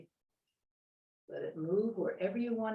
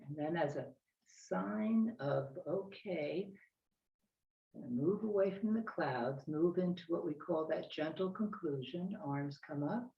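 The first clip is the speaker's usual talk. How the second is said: serene tai chi cues; 4 semitones lower than usual. Acted. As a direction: guide the movement gently.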